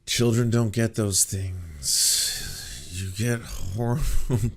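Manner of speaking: drawn-out, extremely slow words; exaggerated slow correction. Real, not acted. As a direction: stretch every word as if spelling it out. Slow-motion rebuttal of the clip.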